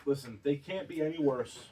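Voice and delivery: quietly insisting. Real, not acted. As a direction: drop the voice and say it again, low. Repeating his point, dropped low.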